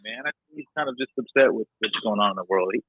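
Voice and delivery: normal talking voice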